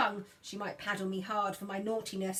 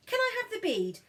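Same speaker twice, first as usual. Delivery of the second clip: put-on much higher, sing-song child's request; coaxing demo; 11 semitones higher than usual. Big sister coaxing silent brother to ask, high sing-song. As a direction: model it brightly for him. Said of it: "You don't even have to say please".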